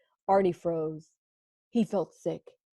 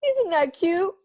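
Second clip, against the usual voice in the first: high, delighted coo; delighted squeal; 10 semitones higher, 4 dB louder than usual. Acted. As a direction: gush with delight. Arnie delighted being lifted.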